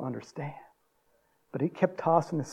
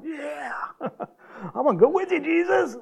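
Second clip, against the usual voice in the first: very high, gleeful yelp with a laugh; comically overexcited, voice pitched way up; acted eager follower; 11 semitones higher than usual. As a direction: play an overjoyed new convert. Voices eager healed man wanting to follow.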